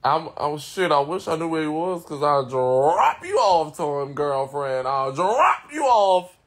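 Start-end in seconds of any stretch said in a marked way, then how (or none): 2.1-6.4 slow, swooping emphatic drawl; drawn-out emphasis. Real, not acted. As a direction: stretch DROP out long and loud. Playful threat on livestream.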